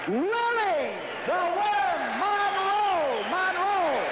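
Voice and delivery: slow, high, stretched fighter name; drawn-out name call. Real, not acted. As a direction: draw out the name for the crowd. Fighter introduction.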